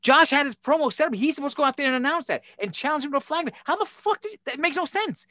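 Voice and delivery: high-pitched exasperated rant; retold rant, pitched high and fast; heated rant. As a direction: vent about the stolen moment. Retold argument with Billy.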